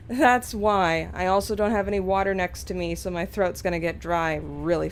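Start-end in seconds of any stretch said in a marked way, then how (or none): none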